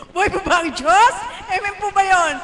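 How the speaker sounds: loud, high call to the crowd; rally the congregation with a loud question. Real, not acted. Host calling loudly to the congregation for a response.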